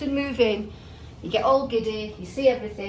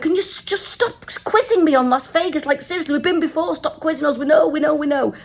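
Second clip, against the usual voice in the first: higher, insistent mock protest; exasperated plea; 6 semitones higher, 3 dB louder than usual. Passenger begging driver to stop quizzing, exasperated.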